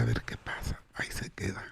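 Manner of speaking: hushed whisper, barely audible; a hidden man whispering inside the confessional. Acted. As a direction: whisper it so no one hears.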